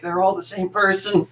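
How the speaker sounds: high-pitched mock exasperation; retold exasperated protest. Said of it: "I'm like".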